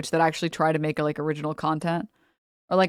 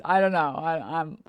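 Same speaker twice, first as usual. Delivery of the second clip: ordinary delivery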